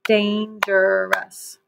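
dangerous stretched slowly into dan-ger-ous; clapped syllables. Shown as a demonstration. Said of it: "Let's clap that word dangerous".

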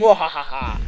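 evil cackle; mad scientist laugh. Pretend. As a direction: gloat like a cartoon villain. Gloating after stocking up weapons.

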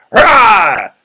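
loud, high mock roar; angry roar. Shown as a demonstration. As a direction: burst out in a demonstrated angry growl. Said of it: "They're angry".

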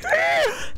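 very high, short squeal; shocked squeal. Real, not acted. Player told of tsunami near Titanic, shocked squeal.

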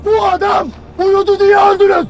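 angry shout. Acted. Commander yelling at recruits about the sleeping soldier, furious shout. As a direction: hurl the accusation at the squad.

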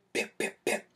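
quick loud whispered pip pip pip; punch sound effect. Retold or imitated. Telling friends about a three-piece combo, loud whispered punch noises. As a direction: mimic three rapid jabs, breathy and punchy.

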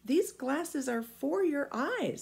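put-on high boyish voice; a small boy correcting the giant; boy Jim answering the giant; young boy.

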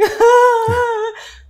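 long, high, loud imitated bawl; mock wailing. Howling in the rickshaw, mock wailing. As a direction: let out a hammed-up howl of crying.

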